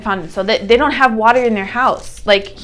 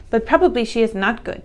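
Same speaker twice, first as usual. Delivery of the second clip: ordinary delivery, nothing marked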